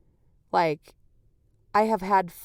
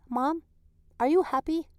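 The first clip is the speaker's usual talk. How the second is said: put-on quoting toddler son; 6 semitones higher than usual. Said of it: "statement that he says to me".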